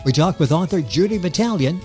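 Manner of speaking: ordinary delivery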